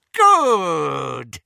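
loud gloating witch snarl; Bellatrix voice. Acted. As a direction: bark it out with cruel glee.

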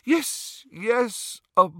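put-on high, wondering joy, bursting on boy; dazed happiness. A narrator's reading. New father dazed by his own news, joyfully overwhelmed. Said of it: "seemed dazed by his own happiness".

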